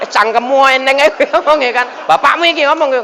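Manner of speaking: loud, high-pitched mock scolding; enraged father. Father raging at his son's remark about dying, angry. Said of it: "Wah, ngamuk bapaknya".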